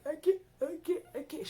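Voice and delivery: high, loud strained I can't; panicked pleading. Retold or imitated. Calling her clinical instructor mid panic attack, high panicked pleading. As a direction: cry out in panic, near tears.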